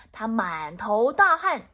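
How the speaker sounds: usual speaking voice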